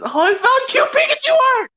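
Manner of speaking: high, loud, delighted shriek; excited squeal. Real, not acted. Excited discovery.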